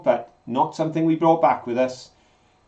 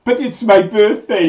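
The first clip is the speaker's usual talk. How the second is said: imitated protest; 6 semitones higher, 6 dB louder than usual. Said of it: "when you said".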